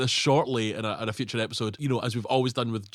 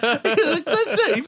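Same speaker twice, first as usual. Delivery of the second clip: ordinary delivery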